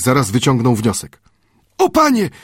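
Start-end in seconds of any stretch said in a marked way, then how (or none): 1.8-2.3 high, eager call to his master; lively high cry. A narrator's reading. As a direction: call out brightly, much higher. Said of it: "zawołał żywo".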